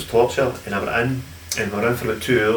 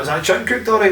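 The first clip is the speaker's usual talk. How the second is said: worried question; 12 semitones higher, 4 dB louder than usual. Retold or imitated. Retold own worried question at dinner.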